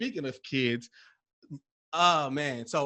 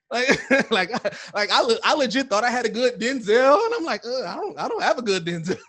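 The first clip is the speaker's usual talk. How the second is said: higher, louder words through laughter; laughing while talking; 7 semitones higher, 10 dB louder than usual. Real, not acted. Host laughing about his failed Denzel impression. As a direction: tell it while cracking up.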